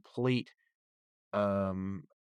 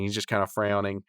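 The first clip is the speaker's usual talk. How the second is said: ordinary delivery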